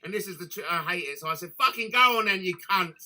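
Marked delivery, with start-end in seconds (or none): 1.6-2.9 loud, exasperated snap at the creep; retold angry outburst. Angrily lets the creep have the room.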